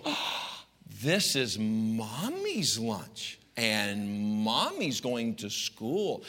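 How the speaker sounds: slow, soft, syrupy mother; sweet mom voice. Retold or imitated. Mother telling her son she joins school, slow and syrupy. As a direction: coo it slowly like a sly mom. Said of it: "how expressive my mother is to me".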